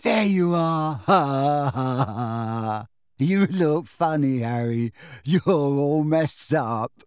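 slow, drawn-out delirious babble; giddy and addled, stretching the words; giggling weakly. A narrator's reading. Dazed boy babbles to his friend, giggly and slurring. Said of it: "giggling weakly".

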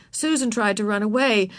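usual speaking voice

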